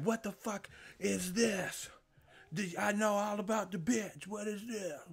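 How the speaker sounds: fuming wife. Retold or imitated. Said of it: "And it's like".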